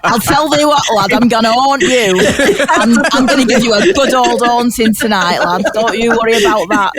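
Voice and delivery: put-on playful ghost with a broad northern English accent; haunt them in broad, chummy northern English; hammed-up Northern English ghostly threat. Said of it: "ghosts always have a northern accent".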